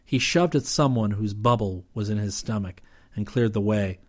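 usual speaking voice

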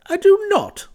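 very high, loud outburst; indignant denial. Acted. Clifford, red, denies her mocking charge, indignant. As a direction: yelp the denial in protest.

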